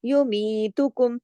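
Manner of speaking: युमीतुकुम with the mi drawn out two counts; stretched mi. Shown as a demonstration. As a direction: lengthen मी in युमीतुकुम. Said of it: "यू मी को थोड़ा खींचा दो हरकत".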